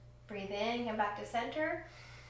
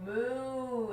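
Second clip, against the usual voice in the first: a playful mooing sound like a cow; 2 semitones higher than usual. Shown as a demonstration. Teacher leading children in cat-cow pose, playful moo. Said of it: "So let's moo for cow".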